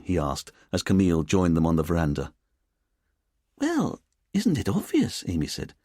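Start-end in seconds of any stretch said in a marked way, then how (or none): 3.6-5.2 put-on lilting Edinburgh accent. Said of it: "in her lilting Edinburgh accent".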